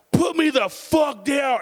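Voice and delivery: high, slow furious shout; angry kid yell. Retold or imitated. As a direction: yell at the uncle holding you. Kid yelling at uncle.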